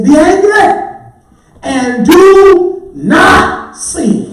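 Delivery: loud, high, drawn-out pulpit shout; ringing preacher's proclamation; proclaimed scripture. Real, not acted. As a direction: cry out the verse, stretching the words slowly.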